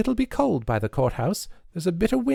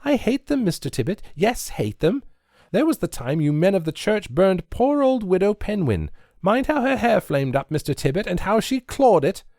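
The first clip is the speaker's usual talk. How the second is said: high, louder torrent of protest; words in a shower; 13 semitones higher, 4 dB louder than usual. A narrator's reading. Nancy railing at Mister Tibbet over burnings, high rushed outburst. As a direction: pour it out all at once. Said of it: "words falling from her in a shower".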